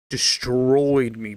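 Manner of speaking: slow, drawn-out destroyed me; dragged out. Acted. Complaining to viewers that they tore him apart, drawn-out. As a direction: stretch the words destroyed me out slowly.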